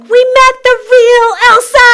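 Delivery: put-on high, loud squeal of delighted kids; kids' gleeful cheer, high and loud; excited schoolchildren. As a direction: shout it like kids thrilled to meet a star. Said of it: "they were like".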